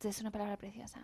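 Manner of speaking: soft unvoiced whisper. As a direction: breathe it out like a secret. Builds up a secret reveal.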